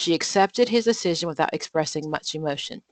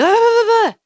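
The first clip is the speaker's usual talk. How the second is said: much higher and louder burst of frustration; mock exasperated yell; 9 dB louder than usual. Mother imitating herself snapping at her youngest child. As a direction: snap at the little one. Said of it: "and I'm like".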